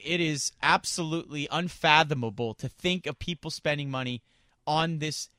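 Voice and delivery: ordinary talk, nothing marked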